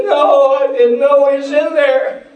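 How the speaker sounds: astonished through tears; tearful old man. Retold or imitated. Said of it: "He just started crying profusely".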